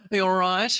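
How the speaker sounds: a hint of a fake Australian; half-hearted Australian accent; terrible Australian accent. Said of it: "speak in terrible Australian accents".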